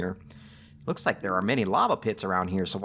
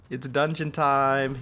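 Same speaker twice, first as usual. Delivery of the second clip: hyped announcer; 5 semitones higher, slower than usual. Shown as a demonstration. Gamer hyping viewers for the dungeon, announcer style. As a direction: belt "It's dungeon time" like a ring announcer. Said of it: "It's dungeon time, baby".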